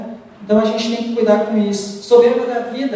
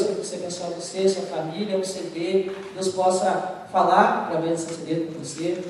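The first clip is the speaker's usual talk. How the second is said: soft blessing; 4 semitones lower, 6 dB quieter than usual. Retold or imitated. Blessing the clerk.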